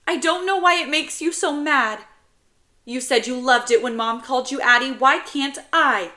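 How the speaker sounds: put-on high, loud little boy protest; protesting child. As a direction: jump in defensively as a kid. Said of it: "protesting before I have a chance to".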